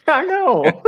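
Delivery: laughing it off. Real, not acted. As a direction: laugh through the words. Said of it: "that was a sarcasm, dude".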